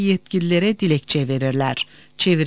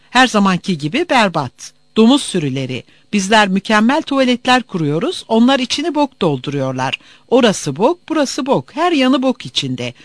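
higher-pitched, contemptuous tirade; scolding checkpoint official; 5 semitones higher, 4 dB louder than usual. Acted. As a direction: berate and order the travellers about. Official berates and searches travellers. (yolcuları azarlayan görevli)

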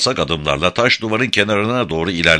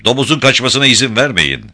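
angry order; 6 semitones higher, 4 dB louder than usual. Acted. Kadim continues his order.

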